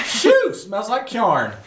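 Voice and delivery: high exclamation into slow Southern drawl; hammed-up Southern twang, drawn out; Southern accent. Shown as a demonstration. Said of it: "say some phrases in Southern".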